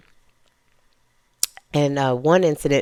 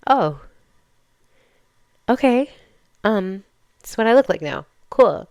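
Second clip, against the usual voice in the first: mock surprise; 6 semitones higher, 5 dB louder than usual. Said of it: "I was just like".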